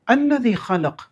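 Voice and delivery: recitation. Shown as a demonstration. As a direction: chant it slowly and high. Recites the verse.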